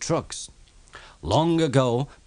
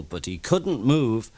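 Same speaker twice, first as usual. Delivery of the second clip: plain reading voice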